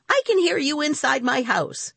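grumpy neighbor crying out; indignant cry. A narrator's reading. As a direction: scold the boys from next door. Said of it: "he cried".

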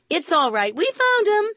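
very high, chirpy call; cried out. A narrator's reading. Rima calls to the woman as Harry flies off, cried out. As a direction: call up to the window, pitch soaring. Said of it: "cried Rima".

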